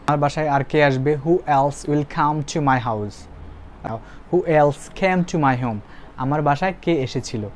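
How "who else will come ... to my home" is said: very slow, every word separated for the class; slow model sentence. Shown as a demonstration. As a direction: read it out slowly like a dictation. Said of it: "teacher models the English sentence". (ধীরে ধীরে ক্লাসে পড়ে শোনানো)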